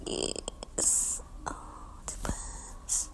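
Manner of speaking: soft whisper. Real, not acted. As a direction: hush over the cat. Whispering over cat.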